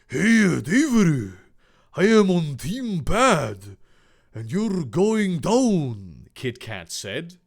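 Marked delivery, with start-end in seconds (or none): 0.1-6.3 slow, heavy mock Japanese accent; hammed-up villain with a badly faked Japanese accent; exaggerated Japanese accent. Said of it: "tremendously racist Japanese accent".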